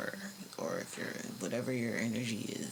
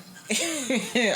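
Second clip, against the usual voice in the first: bright burst of laughter; 10 semitones higher, 5 dB louder than usual. Real, not acted. Laughs after tarot joke.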